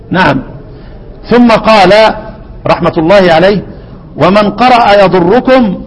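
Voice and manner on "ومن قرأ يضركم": poem recitation. Acted.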